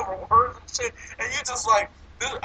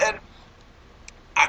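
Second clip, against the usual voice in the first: normal talking voice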